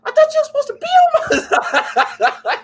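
very high, loud betrayed cry breaking into laughter; hammed-up outrage at a betrayal; a cartoon clown shrieking in mock hurt; Ronald McDonald. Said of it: "When he's like".

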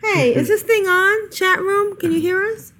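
high-pitched, questioning call to the audience; mock mic check. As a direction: playfully check whether anyone out there can hear. Jokingly calling out to silent chat room.

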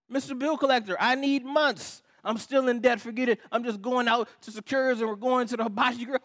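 plead, then throw it all in; mock exasperated debtor. Debtor begs bill collector for months, mock exasperated.

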